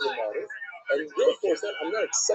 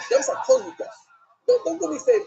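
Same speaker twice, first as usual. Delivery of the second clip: loud, high protest, waving the offer away; emphatic refusal; 9 semitones higher, 6 dB louder than usual. Acted. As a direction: protest loudly and turn the offer down. Mephiboshet refuses half the property.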